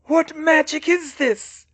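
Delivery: high, loud, slow alarmed villain; frightened dark lord, shouting; fearful. A narrator's reading. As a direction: cry out in baffled fear. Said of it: "A look of fear crossed his face".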